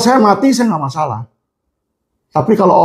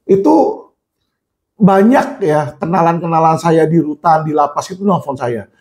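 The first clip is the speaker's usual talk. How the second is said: ordinary talk, nothing marked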